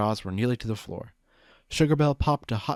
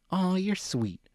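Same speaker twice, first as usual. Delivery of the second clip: flattered; 9 semitones higher, slower than usual. A narrator's reading. Pleased reply to compliment.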